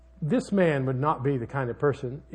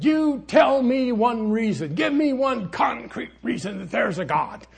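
mock atheist debater shouting, much higher; angry demand; 9 semitones higher, 7 dB louder than usual. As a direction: imitate her hurling the demand at him. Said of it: "she got really mad".

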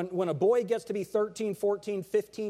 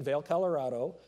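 ordinary delivery

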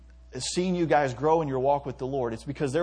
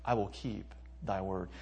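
quiet prayer; 4 semitones lower, 8 dB quieter than usual. Shown as a demonstration. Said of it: "you can say".

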